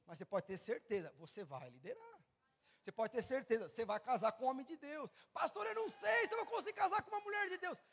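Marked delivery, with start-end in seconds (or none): 5.3-7.8 very high-pitched mock worried objection; anxious protest. Preacher imitating a member doubting marriage to his pastor, high-pitched protest. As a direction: object in a high, put-on plaintive voice.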